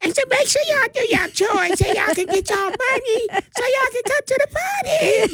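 very high, far-off announcer voice; baby Blow voice. Retold or imitated. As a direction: pitch it way up like a hype radio ad. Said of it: "I do my baby blow voice".

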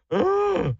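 disgusted gasp. Real, not acted. Recalling disgusting childhood meal.

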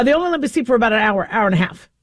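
ordinary delivery

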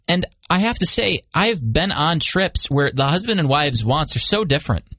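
usual speaking voice

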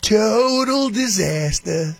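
slow, drawn-out Trump voice, leaning on total; Trump impression. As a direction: stretch it like Trump at a rally. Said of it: "I used to love when Trump would do that".

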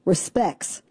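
Respects said as "respecks", dropping the t; Ozark pronunciation. Shown as a demonstration. Spelling out the local Ozark way to say Respects. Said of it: "R E S P E C K S".

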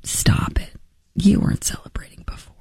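near-whispered scolding; hushed rebuke. Real, not acted. Host rebuking pretend patriots after mimicking them, hushed. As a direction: drop to a whisper and call out the pretence.

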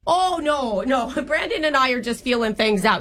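hammed-up Long Island-Boston-Minnesota mom accent; horrible jumbled accent. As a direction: play a chatty older girlfriend in a bad regional accent mash-up. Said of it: "doing a horrible accent".

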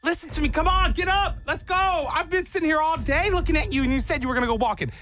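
high, pushy dog nagging its owner; be the dog who wants its walk; impatient dog voice. Pretend. Voicing her dog.

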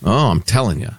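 ordinary talk, nothing marked

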